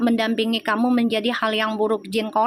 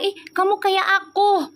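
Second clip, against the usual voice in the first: much higher surprised exclaim; retold own exclaim; 8 semitones higher than usual. To her twin, high startled exclaim. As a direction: gasp it out in wonder. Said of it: "Gue bilang gitu".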